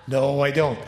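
usual speaking voice

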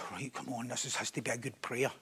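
very low, hushed self-coaching; acted inner self-talk. Someone about to pray publicly, coaching himself in a hushed voice. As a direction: psych yourself up under your breath. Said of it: "You're praying".

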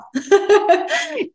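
a real burst of laughter; high bright laugh. Real, not acted. Laughs at own timing joke.

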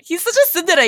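high, loud gushing; excited squeal. Real, not acted. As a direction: gush about the character. Excited fan reaction.

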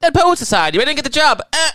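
hammed-up high, loud voice of a boastful YouTuber; Jamie Kennedy. Mocking a comedian's YouTube brags, silly high voice. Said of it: "YouTube channel where he's like".

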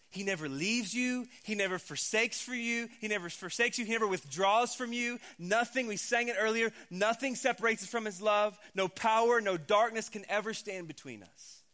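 preaching at full force. Acted. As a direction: preach it out to the whole room.

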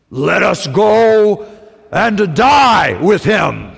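slow, loud, heroic declaration; Braveheart courage. Retold or imitated. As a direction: say it like a brave warrior charging.

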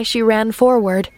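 plain reading voice

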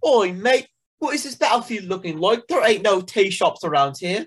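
high, hammed-up Cockney "Oi, mate"; mock British accent. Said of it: "try to do an impression of that".

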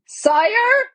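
very high, piercing little call; shrill voice. A narrator's reading. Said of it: "came a shrill voice".

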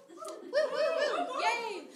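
hammed-up celebration; mock cheer. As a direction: cheer like a party guest. Celebrating flying home.

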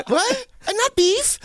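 very high comic squeal; hammed-up squeak of disbelief; squeaky mock protest. Comic replaying the awkward exchange, squeaky mock protest.

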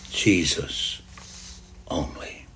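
low, very soft, falling away; hushed echo. Acted. Preacher repeats the reading's last words, hushed echo. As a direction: let the words sink to a near-whisper.

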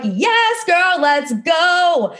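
high cheer into a slow sung "let's go"; playful sung hype. Retold or imitated. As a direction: cheer her on, singing the end. Her own cheering reply.

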